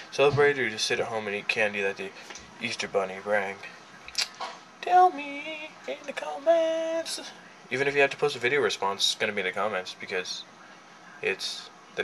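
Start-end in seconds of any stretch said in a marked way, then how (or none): 4.8-7.2 high, slow sing-song. Acted. Calling out to viewers.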